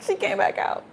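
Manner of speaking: breathy laughing whisper; hushed laughing surprise; giggly whisper. Real, not acted. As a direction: whisper it through a giggle of delight. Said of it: "card reappears".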